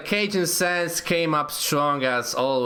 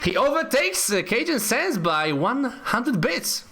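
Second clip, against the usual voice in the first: excited announcement; 9 semitones higher than usual. Real, not acted. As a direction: announce the upset with rising excitement. Announcing the new donation leader.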